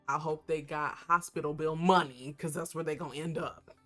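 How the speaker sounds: loud hyped warning. Real, not acted. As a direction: belt it out like a threat. Hyped threat.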